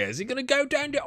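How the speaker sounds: high-pitched mock Australian accent; Australian accent attempt. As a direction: pitch way up for a hammed-up Aussie accent. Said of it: "I don't know what that accent".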